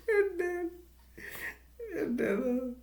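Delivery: high, squeaky words through helpless laughter; laughing. Real, not acted. Storyteller amused by own retelling, laughing hard. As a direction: try to resume while cracking up.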